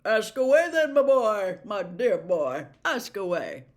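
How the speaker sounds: put-on jovial, booming old professor; plummy teacher inspecting the class's work; Slughorn's voice. Slughorn to the class, checking potions, jovial booming.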